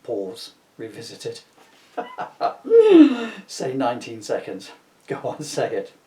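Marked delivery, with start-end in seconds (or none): 2.0-3.4 a loud high laugh breaking out; can't hold the laugh in, loud and high; high burst of laughter. Real, not acted.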